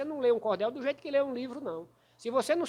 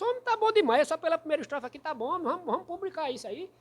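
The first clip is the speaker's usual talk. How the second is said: higher-pitched, eager verdict; enthusiastic quoted approval; 5 semitones higher than usual. Retold or imitated. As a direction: imitate a poet gladly waving it through. Poet enthusiastically approves his cordel. (imitação animada, em tom mais agudo)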